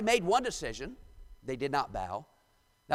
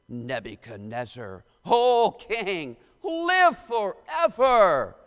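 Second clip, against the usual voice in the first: slow, loud, declaiming the verses; dramatic scripture reading; 3 semitones higher, 8 dB louder, slower than usual. Acted. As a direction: proclaim the King James words like a preacher. Reads scripture aloud.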